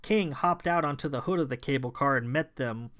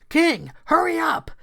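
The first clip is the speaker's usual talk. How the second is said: high, loud urgent yell; 9 dB louder than usual. Acted. Sarah yells to King to hurry, high loud yell. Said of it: "Sarah yelled".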